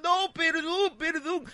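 high wailed imitation of begging; tearful pleading grandmother. Grandmother begging forgiveness, high mock-tearful wail. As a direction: plead like a granny in tears. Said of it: "La abuela entró en lágrimas, pedía perdón".

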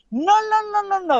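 mock owner refusal. Owner blocks him from the kitchen, high mocking refusal. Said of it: "cuando voy a entrar a la cocina".

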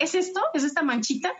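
lightly voiced girl, pitched high; imitated young girl. Daughter asking mom about her first stain, imitated high.

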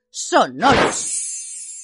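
slow, drawn-out spell word; spell incantation. Acted. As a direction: intone the magic word. Casting a spell.

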